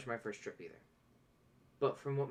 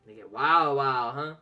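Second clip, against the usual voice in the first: louder teasing repeat; loud echo; 10 dB louder than usual. Acted. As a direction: boom it back playfully. Echoing the southern line loudly.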